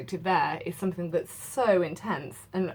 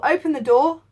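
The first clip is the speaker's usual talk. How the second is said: higher, louder urgent servant; anxious call; 9 semitones higher, 10 dB louder than usual. Acted. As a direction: call through the door to be let in. Servant calls through the door.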